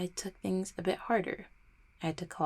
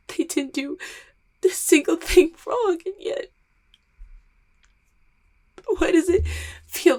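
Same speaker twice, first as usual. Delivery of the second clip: high, loud crying through every word; full tearful breakdown, voice cracking high; sobbing breakdown; 6 semitones higher, 9 dB louder than usual. Acted. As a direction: let it all break open in sobs. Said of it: "I'm fucking crying in front of you".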